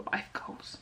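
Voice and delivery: whispered echo of the phrase; a murmured reminder to herself. Real, not acted. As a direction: drop to a hushed aside. Repeats "life goals" under breath.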